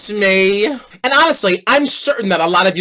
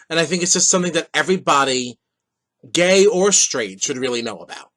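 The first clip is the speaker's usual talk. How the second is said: serious and sincere; 7 semitones lower, 6 dB quieter than usual. Real, not acted. Serious topic announced.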